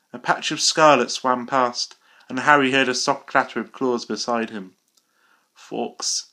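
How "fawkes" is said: barely voiced, thick and faint; a wounded boy forcing a name out; thick weak voice. Dying Harry calls to the phoenix, thick and faint. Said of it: "said Harry thickly".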